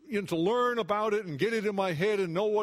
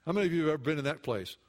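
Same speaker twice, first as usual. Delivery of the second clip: ordinary talk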